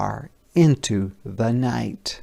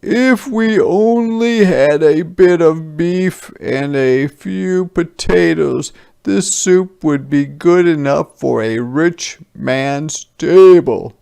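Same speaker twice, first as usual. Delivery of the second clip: soldiers' voice; 6 semitones higher, 7 dB louder than usual. A narrator's reading. Soldier hinting to villagers for cabbage, higher and louder.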